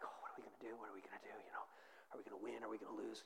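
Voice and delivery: hushed, half-whispered mock fretting; nervous rookie. Preacher voicing a rookie freaking out on the bench, hushed worry. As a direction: imitate anxious muttering under the breath. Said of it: "he's, like, freaking out".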